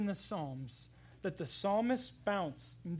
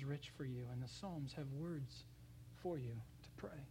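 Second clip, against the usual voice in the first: soft breathy whisper; 5 semitones lower, 12 dB quieter than usual. Real, not acted. Preacher inviting hurting listeners to pray, whispered.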